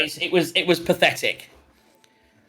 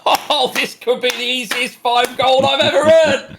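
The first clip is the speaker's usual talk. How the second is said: slow, higher smug taunt; smug gloat; 6 semitones higher, 4 dB louder than usual. Acted. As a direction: savour the easy win, drawn out. Woman gloats after his weak hit.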